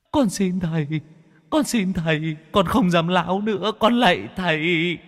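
high, pleading ghost voice, almost sung; whimpering ghost. Acted. Humbled ghost begs the two masters, high and pleading. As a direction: beg like a cowed spirit.

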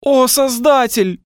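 протяжный, очень высокий вопль; причитай громко, растягивая слова; плачущий стон. A narrator's reading. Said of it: "Плачущие стоны сливались в гул". (протяжный плачущий вопль)

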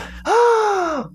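high, drawn inward gasp. Shown as a demonstration. Teacher demonstrating the inward technique, high gasp. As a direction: suck air in with a startled gasp. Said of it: "get that inwards kind of".

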